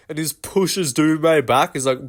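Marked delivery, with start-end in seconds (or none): none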